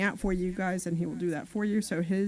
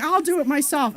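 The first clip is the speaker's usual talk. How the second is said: louder, higher imitation of an insistent husband; retold stubborn insistence; 7 semitones higher, 7 dB louder than usual. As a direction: retell the husband's defiant comeback, raised voice. Retelling husband's insistent reply.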